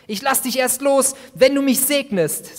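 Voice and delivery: loud, high, desperate plea; snorting through tears, begging loudly; desperate snorting cry. Acted. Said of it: "sagte ich schnaubend, verzweifelt und voller Tränen".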